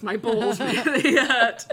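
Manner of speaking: giggling loud complaint; laughing. Real, not acted. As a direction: crack up halfway through the complaint. Repeats complaint, cracking up.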